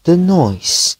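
plain reading voice

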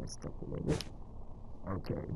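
breathy whisper to herself, under the breath; whispered aside. Real, not acted. Crafter whispering to herself after a small mistake. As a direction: mutter it to yourself, barely voiced. Muttering to self while crafting.

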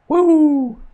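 loud, high celebratory woo; whoop. Real, not acted. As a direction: let out a whoop of joy. Celebrating the wicket.

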